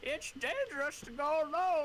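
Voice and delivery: high, loud put-on old codger; old man. Old man warning the departing hero, high-pitched character voice. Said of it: "Hey, it's the old man".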